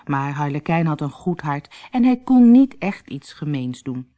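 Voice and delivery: ordinary delivery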